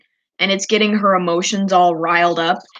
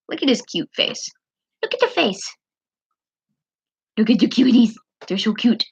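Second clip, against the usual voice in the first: gushing; 4 semitones higher, faster than usual. Real, not acted. Gushing over drawings.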